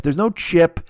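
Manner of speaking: normal talking voice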